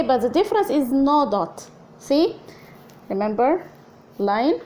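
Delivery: ordinary talk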